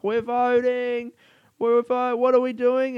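high, loud, giddy shout while running; excited guy. Retold or imitated. Man filming a selfie, imitated excited high yell. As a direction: burst out thrilled about voting. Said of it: "and he's like".

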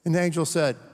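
ordinary delivery

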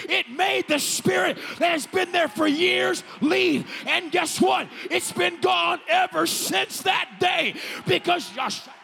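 high, loud, shouted sermon delivery; full-force preaching. Real, not acted. Pastor telling the congregation to speak back to fear, full-force preaching. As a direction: preach it at the top of your voice.

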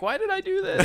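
high wailing mock sob; mock crying Jennifer. To the camera, freaking out, high mock wail. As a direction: whine it like a sobbing actress. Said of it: "she's like".

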